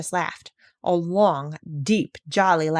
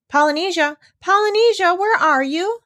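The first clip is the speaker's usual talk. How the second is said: calling a name loudly, higher each time; loud searching call, much higher; 12 semitones higher, 6 dB louder than usual. Acted. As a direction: shout for the missing parrot. Doctor calls for missing parrot.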